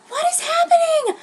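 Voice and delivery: put-on very high, frightened girl's cry. Lettuce, dragged off by a vampire, asks in a very high voice. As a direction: squeal it out like a scared young girl. Girls screaming while kidnapped.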